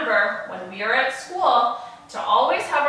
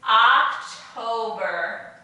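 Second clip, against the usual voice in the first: October drawn out slowly, syllable by syllable; slow model word; much slower than usual. Shown as a demonstration. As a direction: stretch October out for children to repeat. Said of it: "Can you say that with me?".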